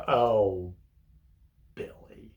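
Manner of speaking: a fading, let-down "Oh, Billy"; disgusted, disappointed. Retold or imitated. Visitor scolding Billy in prison, disgusted and disappointed. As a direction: sound disgusted and disappointed in him. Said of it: "like, with disgust and disappointment".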